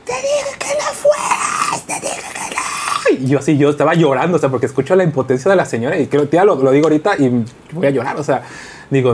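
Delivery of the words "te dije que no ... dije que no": very high, slow, crying shout; desperate sobbing mother. Retold or imitated. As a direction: wail it like a broken mother. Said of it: "el grito, la desesperación". (grito llorando, muy agudo y lento)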